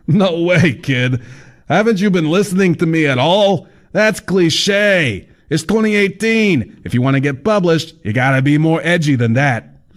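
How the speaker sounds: high, brash know-it-all; fat man voice. A narrator's reading. Laughing biker character.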